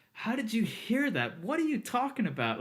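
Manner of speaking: high-pitched, incredulous questioning; baffled disbelief. Retold or imitated. Assistant to mastering engineer hearing hidden details, baffled disbelief.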